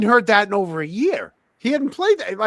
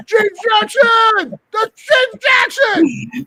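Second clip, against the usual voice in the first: much higher, slow, stretched-out yell; hype man shout; 7 dB louder, much slower than usual. Pretend. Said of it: "can I walk up behind you and go".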